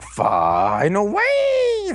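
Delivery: very slow, high, stretched-out cry; mock attack call. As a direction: draw out each word like a finishing move. Imitates the heroes' attack call.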